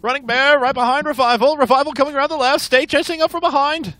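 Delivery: high, loud race-call voice; horse race announcer. Shown as a demonstration. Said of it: "That's my best, like, horse announcer voice".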